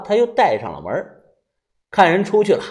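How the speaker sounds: usual speaking voice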